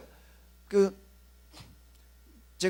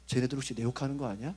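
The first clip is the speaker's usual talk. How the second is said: low, soft, suspicious worry; quiet paranoid whisper-like aside; anxious mutter; 8 semitones lower, 7 dB quieter than usual. Pretend. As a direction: mutter it like you think they're gossiping. Voicing an insecure worrier.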